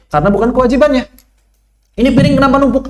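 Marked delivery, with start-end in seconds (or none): 2.0-2.9 husband complaining. Retold or imitated. Husband complains about dishes.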